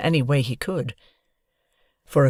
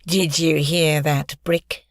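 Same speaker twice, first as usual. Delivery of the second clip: gloating bully; much slower than usual. Acted.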